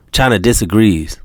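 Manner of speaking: ordinary delivery, nothing marked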